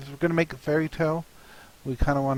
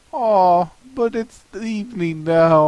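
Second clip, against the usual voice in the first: higher, louder comic whine of letdown; a big dinosaur sulking like a child; disappointed Stegosaurus; 6 semitones higher, 7 dB louder than usual. Acted. Stegosaurus finally home too late, whiny letdown. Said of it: "our Stegosaurus says".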